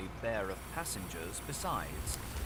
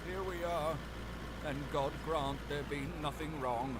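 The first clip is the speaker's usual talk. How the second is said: harsh, broken voice; 6 semitones higher than usual. A narrator's reading. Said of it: "harsh and broken".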